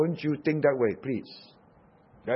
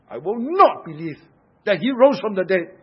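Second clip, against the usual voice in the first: loud, higher refusal with NOT punched hard; very emphatic; 6 semitones higher, 9 dB louder than usual. Acted. Preacher voices doubting Thomas refusing to believe, very emphatic. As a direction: dig in hard on NOT, insisting. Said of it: "Thomas was very, very emphatic".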